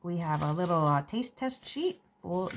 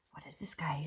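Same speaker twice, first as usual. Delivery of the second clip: whispered, eyeing the cheater; conspiratorial whisper; suspicious whisper; 4 semitones lower, 10 dB quieter than usual. Acted. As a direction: hiss the question to a friend. Suspicious aside about cheating seed.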